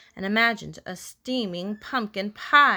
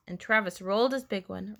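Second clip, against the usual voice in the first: usual speaking voice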